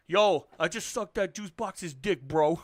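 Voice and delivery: slow, loud bro drawl; douchey bro voice. Retold or imitated. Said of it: "You're like the juice box".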